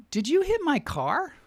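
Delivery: high, swooping accusatory question; accusing question. Retold or imitated. Girl confronting him over her hit car, accusing.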